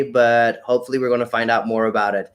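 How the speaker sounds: ordinary talk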